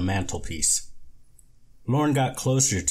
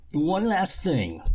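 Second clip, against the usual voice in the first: high, slow, sly voice; high creepy salesman voice; creepy con man; 9 semitones higher, slower than usual. Acted. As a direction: drop it in sweetly and sinister. Lorne adds a creepy question.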